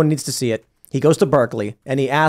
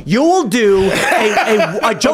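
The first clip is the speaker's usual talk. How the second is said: higher-pitched, worked-up protest; indignant retort; 7 semitones higher than usual. Retold or imitated. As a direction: argue back in exasperation, voice raised in pitch. Acted argument with ChatGPT.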